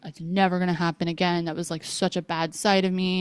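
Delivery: ordinary delivery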